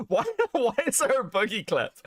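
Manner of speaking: asked through real laughter; laughing question. Real, not acted. Streamer laughing at an unexpected Boogie clip, real laughter.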